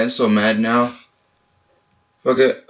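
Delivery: normal talking voice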